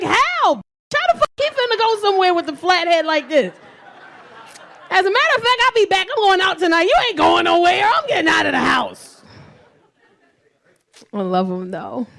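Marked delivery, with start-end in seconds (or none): 0.0-3.5 high, loud disbelief at the flat head; incredulous. Retold or imitated. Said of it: "I'm like". 4.9-9.2 higher, louder mock rebellion; mock defiant. Mock declaring she's going out.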